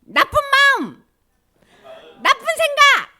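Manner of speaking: high, loud chant-like yells with drawn-out endings; rallying shout. Shown as a demonstration. Teacher leading children to rebuke Satan, rallying shout. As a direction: lead the crowd in banishing cries. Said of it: "한번 해볼까?".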